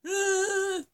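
high, loud mock sobbing wail; imitating a hysterical cry. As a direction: wail it through tears, hammed-up. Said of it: "I'm crying. I'm hysterical".

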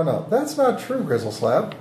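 ordinary talk

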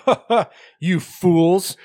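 slow, high hammed-up taunt; mock villain gloat. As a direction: crow it like a pantomime villain. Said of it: "He's like".

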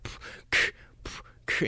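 unvoiced p and k beatbox pops; whispered beatbox sounds. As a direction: softly click out beginner beatbox sounds. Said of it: "they're going like".

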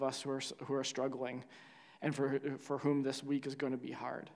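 subdued, reverent pastoral prayer; public prayer. Real, not acted. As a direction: pray gently to God for those struggling.

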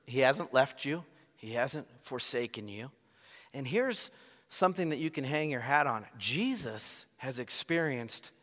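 ordinary delivery

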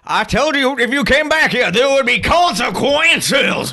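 high, loud threatening yell; enraged hillbilly threat; furious shout. Acted. Father voice, shouting.

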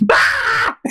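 high squealed "Ah!"; playful retold scream; mock scream. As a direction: shriek like you're being chased. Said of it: "me and her were like".

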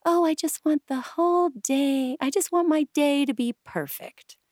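high-pitched mock bride voice; gushing bride. Bride calling the wedding chapel, high mock gushing. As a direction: imitate a bride pleading for perfection. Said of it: "main thing brides say is like".

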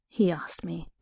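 plain reading voice

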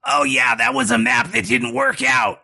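high, put-on creature voice, upset; weeping lizard person. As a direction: lament a failure in a high monster voice. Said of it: "And he's just like".

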